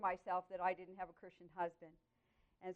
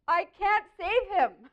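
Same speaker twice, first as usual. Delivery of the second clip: loud, high, drawn-out plea; crying out; 10 semitones higher, 10 dB louder, slower than usual. Retold or imitated. Woman pleading with God over her husband, crying out. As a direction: cry it out slowly, pitch and volume up. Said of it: "I cried out to God".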